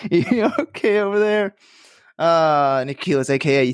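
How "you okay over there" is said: playful catchphrase. Pretend. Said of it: "that's my new segment".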